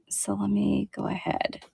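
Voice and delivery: soft unvoiced whisper to herself; whispered aside. Real, not acted. Seller on a live show, whispering to herself while getting the next piece. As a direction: murmur it under your breath while handling the piece.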